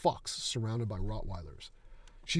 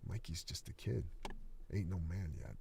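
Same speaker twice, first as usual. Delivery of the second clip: lower, softer, leaning in close; soft menacing murmur; 4 semitones lower, 8 dB quieter than usual. Acted. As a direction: hush it like a predator. Old coach leaning in close.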